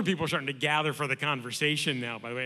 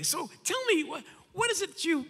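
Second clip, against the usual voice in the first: much higher, mock-curious party guest; prying partygoer; 11 semitones higher than usual. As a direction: lightly play the nosy guest.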